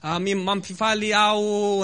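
slow, laboured attempt at Malagasy. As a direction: sound out Malagasy haltingly, as a foreigner. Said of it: "trying to use my best Malagasy".

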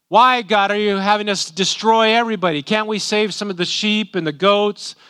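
high, loud questioning of God; protesting plea. Acted. Israelites asking God to spare the animals, protesting plea. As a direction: plead in mock protest at the order. Voices the people questioning God's command.

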